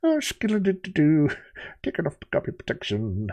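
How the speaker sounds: lightly voiced cartoon sailor; a hint of a gruff cartoon sailor; Popeye voice. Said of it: "He'd be like".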